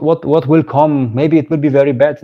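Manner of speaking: normal talking voice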